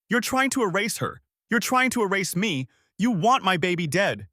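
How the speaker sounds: high, frantic accusations; feral screaming. A narrator's reading. Sister hitting the widower with both fists, feral and frantic. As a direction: hurl each accusation in a wild, high voice. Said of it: "Her voice went feral".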